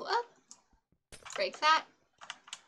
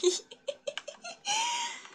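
high shrieking laugh. Real, not acted. Lacht über sich selbst.

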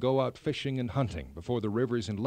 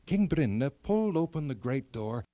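usual speaking voice

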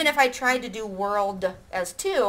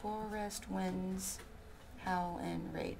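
slow, much softer recount; 2 semitones lower, 14 dB quieter, slower than usual. Shown as a demonstration. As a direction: quietly recount each syllable. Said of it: "some of these aren't quite right".

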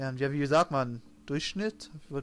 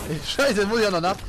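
loud frustrated outburst; 4 semitones higher, 6 dB louder than usual. Real, not acted. Caught needing to reload mid-fight.

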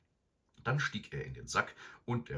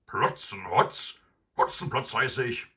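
louder, higher indignant robber; indignant correction; 5 semitones higher, 9 dB louder than usual. A narrator's reading. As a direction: set the name straight. Robber correcting his name.

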